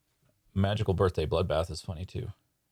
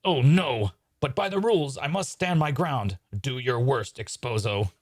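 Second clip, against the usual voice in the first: high, noble stage declamation; melodramatic magician; 6 semitones higher, 4 dB louder, slower than usual. Acted. Hydini facing Exposo under the rules, melodramatic defiance. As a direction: declaim bravely, easing down toward the end.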